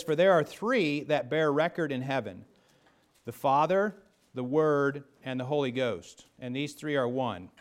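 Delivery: loud, ringing pulpit reading of the verse; scripture reading. Acted. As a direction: proclaim the verse to the congregation. Reads scripture aloud in pulpit voice.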